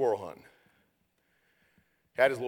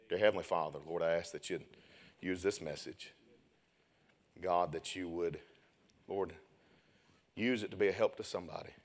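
prayer; 4 semitones lower, 4 dB quieter than usual. Acted. Closing prayer.

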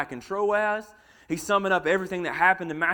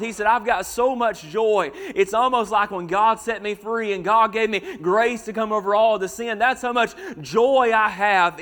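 fervent preaching; 3 semitones higher, 5 dB louder than usual. Acted. Preacher pressing the church on Paul's joy, fervent and loud. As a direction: lift the congregation with rising fervour. Preacher builds to full force.

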